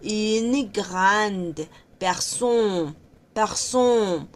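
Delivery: slow Une grande personne, final E silent; French phrase demo. Shown as a demonstration. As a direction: personne ending on the N. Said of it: "la E tampoco se pronuncia".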